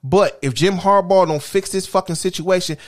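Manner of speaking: ordinary talk, nothing marked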